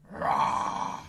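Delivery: low breathy rah; lion roar. Shown as a demonstration. Magician demonstrating animal sounds, low lion roar. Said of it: "and someone shouted, "Lion".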